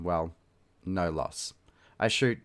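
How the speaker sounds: ordinary delivery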